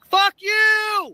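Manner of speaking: high, loud yell at the cop; loud, high, furious shout; angry shout. Real, not acted. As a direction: hurl it at him as he drives off. Yelling at passing police officer.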